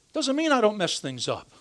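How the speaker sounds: ordinary talk, nothing marked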